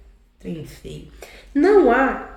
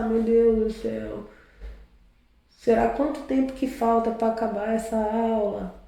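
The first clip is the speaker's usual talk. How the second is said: aluno pensando alto; 6 dB quieter than usual. Acted. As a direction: wonder to yourself, wishing class would end. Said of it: "Às vezes você tá lá". (aluno suspirando baixinho, cansado da aula)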